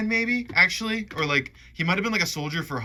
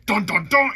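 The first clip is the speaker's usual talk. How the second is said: louder, higher mock suspense sting; dramatic sting; 8 semitones higher, 7 dB louder than usual. Film fan acting out the big puzzle reveal, mock-dramatic sting. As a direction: punch out a movie reveal sting.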